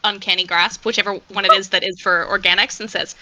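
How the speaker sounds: usual speaking voice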